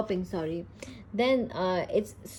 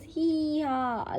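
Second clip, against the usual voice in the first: higher-pitched pretend laugh; storybook laugh; 7 semitones higher than usual. A narrator's reading. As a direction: read out Peppa's laugh, pitched up. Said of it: "laughs Peppa".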